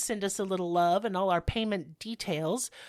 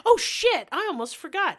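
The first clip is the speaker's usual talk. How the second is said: surprised; 10 semitones higher, 6 dB louder than usual. Real, not acted. Suddenly remembers the new show.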